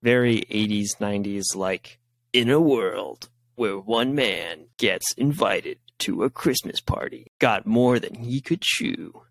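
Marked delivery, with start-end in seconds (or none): 2.3-9.2 slow, dramatic trailer narrator; movie trailer voice. Retold or imitated. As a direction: read it like an eighties blockbuster trailer. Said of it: "the trailer is very eighties".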